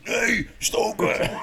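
high, loud, drawn-out yell; mock-furious shouted command; imitated shouted refusal. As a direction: bellow it like a bossy comrade. Luc refuses, quoted in story.

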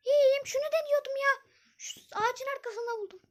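put-on high, laughing young girl. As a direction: play the giggly girl friend. Zeynep answers her friend.

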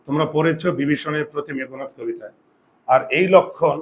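ordinary talk, nothing marked

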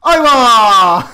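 loud burst of laughter; surprised laugh. Real, not acted. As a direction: crack up at the fall. Enemy falls off map.

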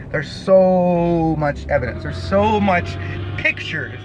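slow, deliberately spelled-out words; mock slow explanation. Shown as a demonstration. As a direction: drag each phrase out as if to a slow listener. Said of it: "I guess I'll speak slower".

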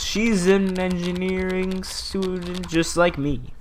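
put-on coy answer. Player answering who Emma is, drawn-out and coy. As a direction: stretch it out in a high put-on voice.

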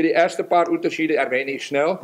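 normal talking voice

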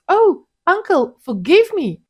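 high, loud fake sorry; mock apology. A narrator's reading. Wife feigning regret while breaking the plate, high and loud. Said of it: "deliberately knocked over one of the plates".

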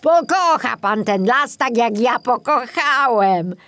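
put-on a touch of fond reassurance from the old forester; reassuring. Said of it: "zapewnił go Kobiela".

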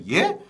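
"예?" high and rising; 되묻는 예?. Retold or imitated. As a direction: query it back with a high "예?". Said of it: "라고 이렇게 물어봤단 말이야". ("예?" 끝을 올려 높게 묻기)

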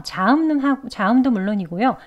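usual speaking voice

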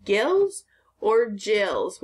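"gills" with a hard g, then "jills" with a j, very slow; contrasting g sounds. Shown as a demonstration. Teacher asking the class which g sound fish have, very slow. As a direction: drag out both choices slowly. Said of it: "fish has".

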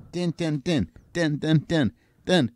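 high, short repeated drum noises; imitated snare drum. Shown as a demonstration. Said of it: "how much he uses snare drums".

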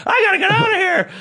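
high, hurried imitation of a panicked woman; mock panic. Woman fleeing after a scare, imitated in high panic. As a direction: squeal it fast in mock alarm. Frightened woman quoted wanting to leave.

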